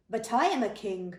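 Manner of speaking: loud, angry booming king. Acted. King booms at Samuel, loud and furious. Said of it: "boomed Saul".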